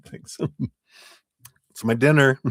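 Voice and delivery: ordinary delivery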